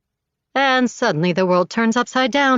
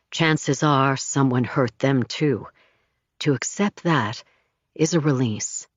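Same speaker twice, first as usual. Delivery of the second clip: calm therapist; 6 semitones lower than usual. A narrator's reading. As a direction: gently guide toward forgiveness.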